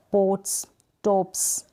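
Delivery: पोट्स and टॉप्स each ending on a voiceless s; hissed final S. Shown as a demonstration. Teacher recaps the S-sound examples, hissed final S. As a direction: finish पोट्स, टॉप्स with no added vowel. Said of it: "स करके इसका जो sound है".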